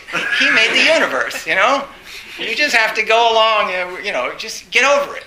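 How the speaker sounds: loud, high, exasperated retelling; mock rabbi lecture. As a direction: preach it with exasperation.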